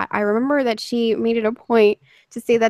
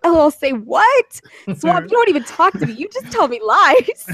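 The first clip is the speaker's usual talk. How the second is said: mock indignation; 6 semitones higher than usual. Retelling Eden's comeback to Swamp, mock indignant. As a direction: imitate an affronted friend, stretching the accusation.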